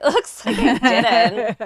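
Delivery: laughing through the words. Real, not acted. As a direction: giggle out the admission. Laughs at her own missing fades.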